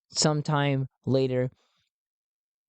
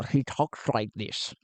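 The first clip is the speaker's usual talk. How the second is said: lower, sophisticated Connery-like voice; Len Onya; 3 semitones lower than usual. Shown as a demonstration. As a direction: play a cultured Sean Connery type. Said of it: "almost like a Sean Connery kind of thing".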